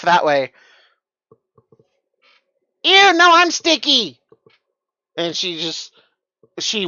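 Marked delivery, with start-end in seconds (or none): 2.8-4.2 high loud grossed-out squeal; disgusted squeal. Acted.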